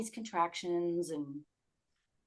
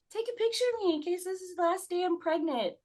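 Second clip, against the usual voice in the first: much higher, sing-out request voice; high-pitched quote; 12 semitones higher than usual. Retold or imitated. Pregnant woman asking for a photo, high-pitched quote. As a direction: imitate yourself asking in a high voice. Acting out her pre-birth request.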